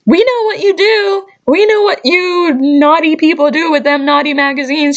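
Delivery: high, teasing mock-scold. Vlogger teasing customers who return naughty magazines, mock scolding. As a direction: wag a finger at the naughty customers.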